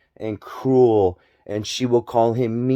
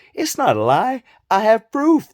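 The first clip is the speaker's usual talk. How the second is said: insisting; 9 semitones higher, 4 dB louder than usual. Retold or imitated. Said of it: "When you say".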